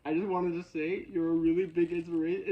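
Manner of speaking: high, loud, half-sobbing gush of admiration; mock tearful gush. Fan crying to musician, high and loud tearful gush. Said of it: "then just start crying and then being like".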